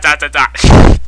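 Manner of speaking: normal talking voice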